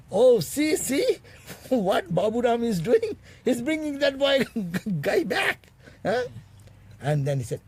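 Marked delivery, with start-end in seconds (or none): 0.1-6.2 high, loud, laughing mock exclamation; a high, laughing call from across the yard; mock exclaiming Swamiji. Swamiji spotting Baburam bringing the cook back, mock exclaiming. Vivekananda mocking from afar.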